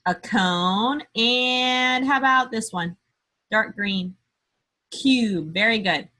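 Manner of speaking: normal talking voice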